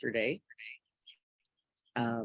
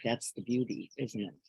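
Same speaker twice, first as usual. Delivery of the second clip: ordinary talk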